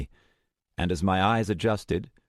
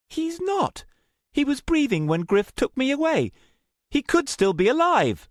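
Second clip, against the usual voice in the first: higher, louder pleading voice; pleading young woman; 4 dB louder than usual. Acted. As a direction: plead for the friend left behind. Luna stung, insisting.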